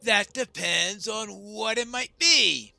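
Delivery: loud, high, slow objection; challenging dwarf heckler. Acted. Dwarf in a discontented crowd, loud challenge. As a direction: call it out over the crowd.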